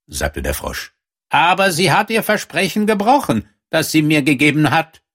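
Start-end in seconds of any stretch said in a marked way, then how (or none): 1.3-5.0 high frog character voice; aggrieved frog. A narrator's reading. Frog accuses the princess before the king, high-pitched complaint.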